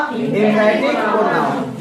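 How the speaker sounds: very slow, each syllable drawn out; slow drill answer. Shown as a demonstration. Teacher drilling the term very slowly. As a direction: spell it out slowly for the class. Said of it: "क्या नाम है इनका?".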